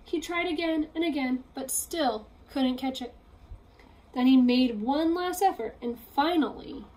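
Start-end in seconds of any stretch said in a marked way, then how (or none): none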